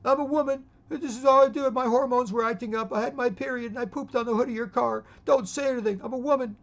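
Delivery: high, loud mocking whine. Mocking a man excusing a mess, in a high loud whine. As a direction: ham up a whiny excuse. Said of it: "He's like".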